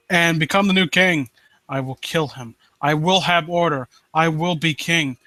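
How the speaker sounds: low, brooding villain read; a hint of a vengeful prince reading his vow; dramatic story reading. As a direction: read it like a scheming usurper, low and steady. Continues villain monologue reading.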